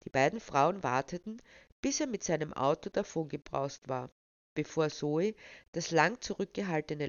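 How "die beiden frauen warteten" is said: ordinary delivery, nothing marked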